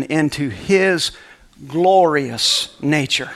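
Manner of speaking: slow emphasis. Acted. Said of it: "slow emphasis".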